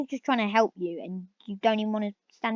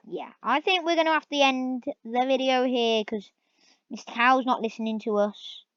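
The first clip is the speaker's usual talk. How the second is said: normal talking voice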